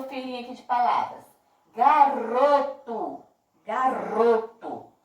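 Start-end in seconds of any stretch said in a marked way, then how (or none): 1.7-3.2 "Garoto" slow and loud, RO punched; stressed middle syllable. Shown as a demonstration. Teacher asking which syllable is stronger, slow forceful RO in "Garoto". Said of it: "que eu falei com mais força". 3.6-4.9 "Garoto" slowly, leaning on RO; stressed middle syllable. Shown as a demonstration. Teacher repeating for pupils, slow "Garoto" with stressed RO. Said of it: "que eu falei com mais força".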